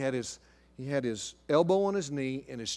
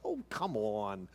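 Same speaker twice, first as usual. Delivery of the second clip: wryly reassuring; 3 semitones higher, 3 dB louder than usual. Retold or imitated. Husband waving away wife's fear, mock reassuring. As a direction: brush off the worry with mock reassurance. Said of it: "I'm real reassuring and comforting to her".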